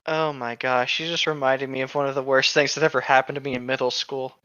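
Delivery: ordinary delivery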